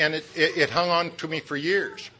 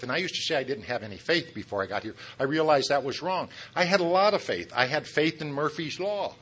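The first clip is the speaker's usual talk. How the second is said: ordinary talk, nothing marked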